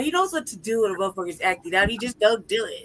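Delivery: ordinary delivery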